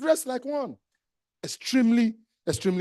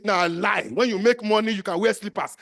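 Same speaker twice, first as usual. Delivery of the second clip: ordinary talk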